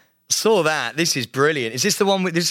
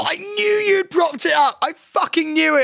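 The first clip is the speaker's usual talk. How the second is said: very high, gleeful I-knew-it; triumphant outburst; 9 semitones higher than usual. Real, not acted. Friend catching out a staged photo, triumphant. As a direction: burst in vindicated, voice leaping up then easing.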